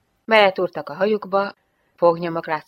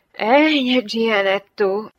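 loud, drawn-out sigh, pitch raised; 5 semitones higher, 7 dB louder, much slower than usual. A narrator's reading. Jane to Gianetto about their affair, sighing. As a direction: sigh it out slowly, high. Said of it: "sóhajtotta a lány".

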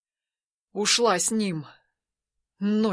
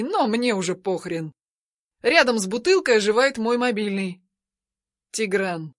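ordinary delivery, nothing marked

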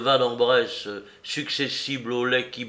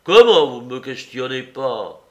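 « Comment » lancé fort, indigné; s'offusque bruyamment; indignation outrée; 8 dB louder than usual. Acted. Soupe indigné réplique. (Soupe indigné répète la phrase, plus fort)

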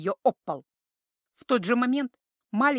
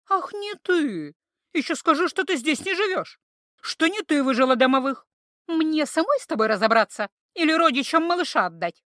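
angry mockery; 5 semitones higher, 3 dB louder than usual. A narrator's reading. Angry interrogation.

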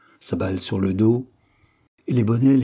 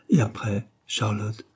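plain reading voice

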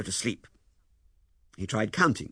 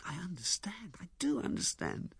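hushed, breathy reassurance; tender, understanding; 9 semitones higher, 7 dB quieter than usual. Acted. Lewisham tells Ethel he understands, tenderly. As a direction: soothe her almost in a whisper.

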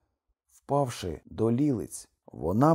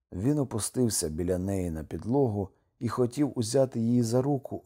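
ordinary delivery

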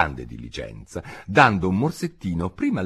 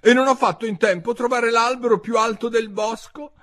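high, loud complaint; high-pitched indignant bird; aggrieved pigeon; 5 dB louder than usual. A narrator's reading. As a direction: work up the outrage, high. Pigeon's grievance builds.

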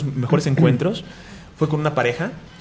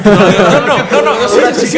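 excited denial; 9 semitones higher, 11 dB louder than usual. Real, not acted. Laughing denial on stage.

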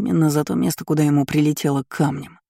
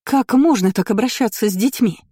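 higher voice full of condemnation; reproachful noblewoman; 7 semitones higher, 3 dB louder than usual. A narrator's reading. Aristocrat condemns how children are treated, reproachful. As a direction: scold as an affronted mother. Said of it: "полный осуждения".

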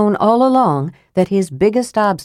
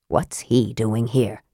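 Flint demanding; 7 semitones lower, 5 dB quieter, slower than usual. A narrator's reading. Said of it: "Flint demanded".

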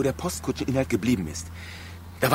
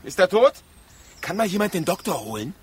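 alarmed cry; 7 semitones higher, 3 dB louder than usual. Acted. Alarmed at the injured man.

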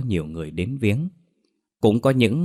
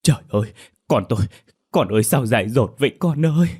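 grieving cry; 4 semitones higher, 4 dB louder, faster than usual. A narrator's reading. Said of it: "gào thét".

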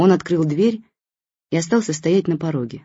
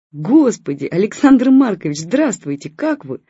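startled, a touch higher, flustered greeting; gasping surprise; 5 semitones higher, 6 dB louder than usual. Acted. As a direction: caught off guard by an old friend's call. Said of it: "Ахнула моя мама".

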